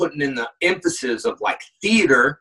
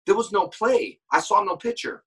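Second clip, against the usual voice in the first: ordinary delivery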